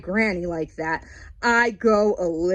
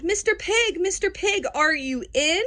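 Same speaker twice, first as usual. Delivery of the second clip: higher, sing-song call; 8 semitones higher than usual. Retold or imitated. Wolf calls to the pig at the brick house, sing-song. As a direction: call sweetly to the pig inside. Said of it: "I called".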